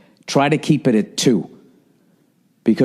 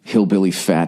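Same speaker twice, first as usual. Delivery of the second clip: usual speaking voice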